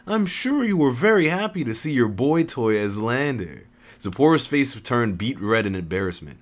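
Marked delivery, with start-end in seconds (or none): 0.1-3.7 higher-pitched teasing jab; mocking. A narrator's reading. Teaser needling her rival about a boy toy, mocking. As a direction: tease with mock certainty. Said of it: "her expression changed to mocking again".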